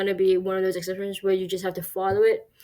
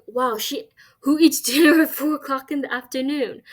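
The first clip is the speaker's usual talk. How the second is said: amazed; 5 semitones higher, 3 dB louder than usual. Pretend. Acted amazed remark about dinner.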